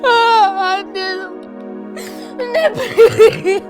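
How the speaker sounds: put-on child wailing over his mother; sobbing. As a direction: cry out for her to wake. Child crying over mother's body.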